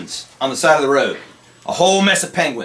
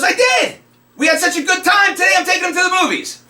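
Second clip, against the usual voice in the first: much higher, shouted and cheerful; gleeful punchline; 13 semitones higher than usual. Acted. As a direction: belt the punchline like a proud driver. Punchline driver in told joke.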